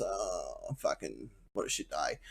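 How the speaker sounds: whispered, very soft grumble of a sorry crow; muttering crow. Retold or imitated. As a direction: mutter it under your breath like a hard-done-by bird. Voicing the injured crow.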